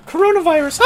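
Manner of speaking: very high, loud screechy hag. Retold or imitated. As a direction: shriek it like an old hag. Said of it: "like a hag like I just sounded".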